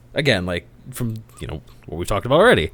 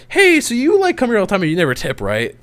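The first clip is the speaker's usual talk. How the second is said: mock server voice; 7 semitones higher than usual. Mocking server's accusation.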